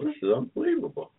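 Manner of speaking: high, astonished exclaim; amazed. Real, not acted. Host marveling at the Braves' trade, amazed. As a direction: let the disbelief lift the voice.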